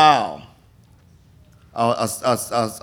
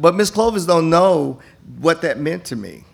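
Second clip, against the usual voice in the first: ordinary delivery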